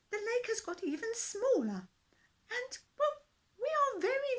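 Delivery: a tiny king politely begging a giant; worried fish king. A narrator's reading. Fish king asks the dinosaur to leave, worried and high.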